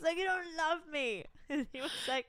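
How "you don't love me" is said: high, slow sulky accusation; hammed-up lovers' tiff complaint; drawn-out childish protest; mock wounded whine. Retelling own tantrum at James.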